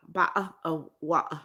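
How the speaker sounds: imitated British accent, t's dropped; British glottal stops. Shown as a demonstration. Streamer demonstrating British pronunciation to a viewer, British glottal stops. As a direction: swallow the t's like a British speaker. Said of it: "They say bottle".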